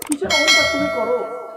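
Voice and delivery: rebuking. Acted. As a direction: scold the listener for pride. Rhetorical rebuke.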